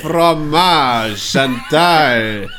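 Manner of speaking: loud, hammed-up French chef voice; mock French chatter, loud and hammy.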